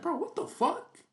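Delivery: high-pitched disbelieving exclamation; real incredulous outburst. Real, not acted. Streamer reacting to the husband's confession, high incredulous outburst.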